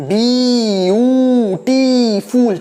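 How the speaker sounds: Beautiful stretched into four long, separate syllables. Shown as a demonstration. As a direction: say beautiful very slowly, a breath for each syllable. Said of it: "চারবার শ্বাস নিতে হয়".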